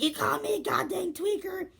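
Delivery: very high, squeaky mimicry; mock outrage. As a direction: mimic her indignation way up in pitch. Imitates girl angry at insult.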